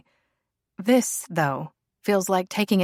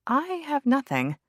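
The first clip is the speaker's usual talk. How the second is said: plain reading voice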